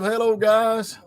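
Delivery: lightly voiced high chirpy greeting; a hint of a camp TV host; Alan Carr. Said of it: "Alan Carr and stuff".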